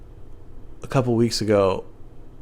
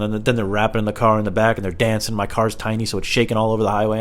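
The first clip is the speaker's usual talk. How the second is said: usual speaking voice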